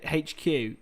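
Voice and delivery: usual speaking voice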